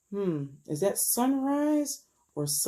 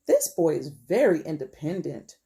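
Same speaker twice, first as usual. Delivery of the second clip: plain reading voice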